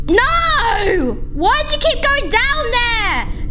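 high, drawn-out cry of frustration; exasperated outcry. Real, not acted. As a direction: wail at a thing that won't behave. Item keeps falling down game stairs.

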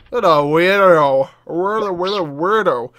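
slow, wobbly "weirdo"; drawn-out goofy mockery; silly voice. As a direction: drag out "weirdo" in a goofy put-on voice. Repeats remark in silly wobbly voice.

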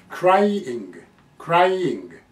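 クライイング as "cry", then a clear "ing"; full stem plus "ing". Shown as a demonstration. Teacher modelling the next word, クライイング in two clear parts. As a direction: lengthen "cry" before adding "ing" in クライイング. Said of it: "こっちははっきりそのまま発音しなきゃ".

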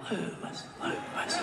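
whispered calling of his name; whispering Lewis, Lewis from the crowd; mock crowd whisper. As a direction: hiss the name like an impatient crowd. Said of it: "you're all gonna go".

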